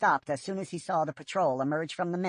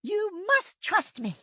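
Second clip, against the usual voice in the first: slow, higher and a touch louder, firm; growling old she-cat; 3 dB louder, slower than usual. A narrator's reading. Yellowfang insisting the apprentices trust her, slow growl. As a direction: press every word home with grit. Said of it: "growled Yellowfang".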